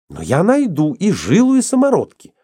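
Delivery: high-pitched, insistent vow; stubborn. A narrator's reading. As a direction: insist obstinately, voice pushed up high. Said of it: "упрямо сказала она". (упрямо, настойчиво)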